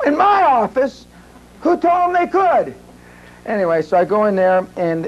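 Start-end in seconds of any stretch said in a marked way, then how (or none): 0.1-2.8 much higher, outraged questions; indignant. Retold or imitated. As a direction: bristle at the news, pitch climbing. Indignant retold outburst.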